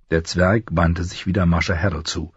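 ordinary delivery, nothing marked

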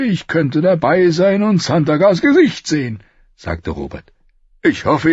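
high, loud, merry outburst as the king; amused king. A narrator's reading. King joking to his friend about the squires, amused. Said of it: "bebte vor Lachen".

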